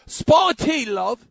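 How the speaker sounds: hammed-up English accent, pitched high, loud opening; cheeky mock-English tea offer, high and exaggerated. Shown as a demonstration. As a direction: put on a high-pitched Cockney-style English voice. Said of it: "when I do my English accent".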